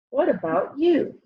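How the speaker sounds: plain reading voice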